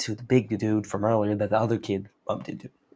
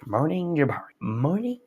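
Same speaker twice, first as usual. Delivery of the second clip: higher-pitched sing-song robot greeting; put-on synthetic voice-assistant tone; 5 semitones higher than usual. Shown as a demonstration. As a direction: imitate an automated assistant saying good morning. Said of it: "saying it in a robot tone".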